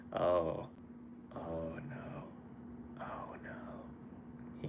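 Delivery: hushed dismayed whisper. Real, not acted. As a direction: breathe it out in dismay. Reacting to sad chat news.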